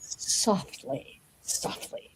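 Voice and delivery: breathy whisper. Shown as a demonstration. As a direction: whisper it softly. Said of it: "tell us about that soft knock".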